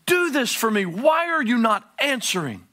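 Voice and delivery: loud, high demanding cry; frustrated shout. Acted. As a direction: shout it up at God. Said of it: "it's voiced and it's aimed at God".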